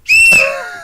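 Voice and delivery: very high, loud whooping laugh. Real, not acted. Toasting non-seafood guys.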